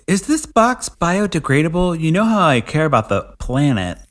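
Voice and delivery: high mock-innocent voice; dumb husband. Husband fussing over the box to the wife, high and mock-innocent. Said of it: "and then he's like".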